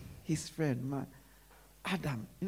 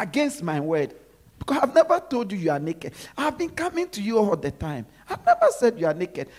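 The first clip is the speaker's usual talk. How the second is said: emphatic protest; 3 semitones higher, 7 dB louder than usual. Acted. God protesting to Adam, louder.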